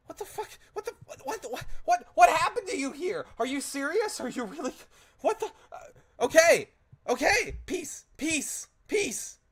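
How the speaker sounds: shrill panicked shrieking, very high and loud; terrified squeal. Acted. Captured Fae shrieks in terror at the turret, very high and loud. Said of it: "she begins to let out a squeal of terror".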